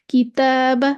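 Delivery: "किताबा" with a thin, light long alif; बारीक अलिफ. Shown as a demonstration. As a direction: keep the "ता" in "किताबा" light and fronted. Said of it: "इसको भी हम बारीक पढ़ेंगे". ("किताबा" का अलिफ बारीक पढ़ें)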